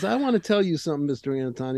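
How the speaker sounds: put-on angry Southern prosecutor, Arkansas accent. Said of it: "He got the heavy Arkansas accent".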